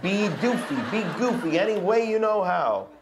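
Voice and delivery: lively, teasing storybook read; reading to children. Acted. Dad reading the book to his kids, playful dare. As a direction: dare the kids to make him laugh.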